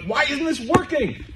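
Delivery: high, drawn-out exasperated complaint. Acted. Acting out a listener whose efforts fail, high exasperated whine. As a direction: whine it in mock frustration. Voices a frustrated person's complaint.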